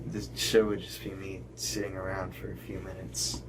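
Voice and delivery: ordinary delivery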